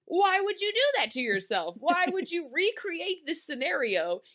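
high, incredulous scolding; hammed-up bafflement at a bad plan; mock exasperation. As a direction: plead in comic disbelief.

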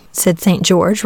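ordinary delivery, nothing marked

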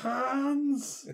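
high-pitched, drawn-out mock call; mimicked high wail of a name; mock cry of a name. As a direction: call it out high, as a jokey impression. Quoting wife's cry on seeing him.

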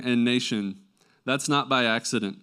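ordinary talk

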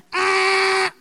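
loud, held buzzer noise; game-show buzzer. Retold or imitated. Showing the congregation a TV quiz button, loud buzzer imitation. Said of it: "vous devez appuyer sur le bouton".

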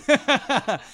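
high, hearty laugh; burst of laughter. Real, not acted. Host laughs at a viewer's joke comment, hearty and high.

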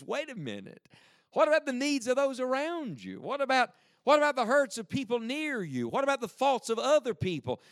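apostle pleading. Acted. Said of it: "He says".

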